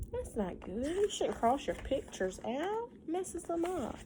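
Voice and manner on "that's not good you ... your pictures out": ordinary delivery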